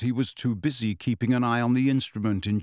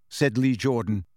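ordinary delivery

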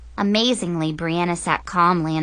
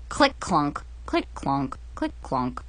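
limping footsteps fading; 5 semitones lower, 5 dB quieter than usual. Acted. Narrator mimics Mackenzie hobbling off, fading. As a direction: click clunk, getting quieter.

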